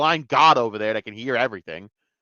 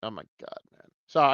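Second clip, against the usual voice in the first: ordinary delivery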